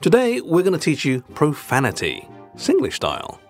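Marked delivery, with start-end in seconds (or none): none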